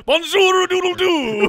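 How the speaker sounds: high, slow mock rooster crow; French rooster crow.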